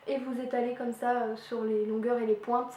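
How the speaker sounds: ordinary talk, nothing marked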